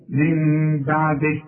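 من بعده said very slowly with the ghunna held; stop recitation. Shown as a demonstration. Teacher showing the pause reading of the verse. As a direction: draw out من بعده as if stopping on it. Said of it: "إذا وقفت تقول إيه".

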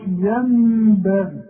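ينبغي with a full clear meem, no hiding; wrong pure meem. Shown as a demonstration. Teacher mimics second mistake, clear meem. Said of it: "وكمان ما نقولش".

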